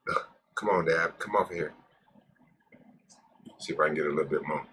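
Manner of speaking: ordinary delivery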